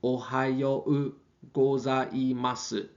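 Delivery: gozaimasu drawn out slowly, every syllable clear. Shown as a demonstration. Teacher slowing gozaimasu down for students, slow and clear. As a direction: spell out gozaimasu slowly for the class. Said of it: "formal is".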